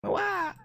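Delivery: high-pitched "waah"; mock crying wail. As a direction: wail like a badly faked cry. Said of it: "Senpai cries even louder".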